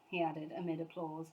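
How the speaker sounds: usual speaking voice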